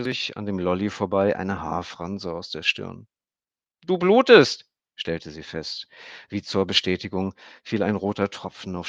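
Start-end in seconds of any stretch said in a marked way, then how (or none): 3.9-4.7 put-on high, loud girl's voice; a child stating a fact; little girl. A narrator's reading. Emmy notices Stefan bleeding, high girl's voice.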